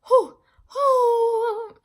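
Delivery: high, loud panicked huffing breaths; nervous gasps. Retold or imitated. Waiting for the audition call, gasping in panic. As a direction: puff out in retold panic. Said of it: "I was just like".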